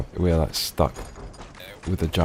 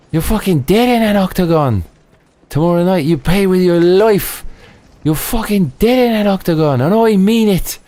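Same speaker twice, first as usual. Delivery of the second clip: much higher, louder fight-night threat; imitated trash-talk yell; 12 semitones higher, 4 dB louder than usual. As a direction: scream it across the octagon at your rival. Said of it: "And he goes".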